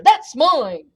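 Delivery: loud bear's claim; forceful storybook yell. A narrator's reading. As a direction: yell it, staking your claim. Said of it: "yelled the bear".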